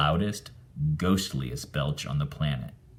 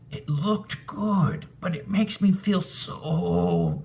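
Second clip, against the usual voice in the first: sick ghost moan; 12 semitones higher, slower than usual. Acted.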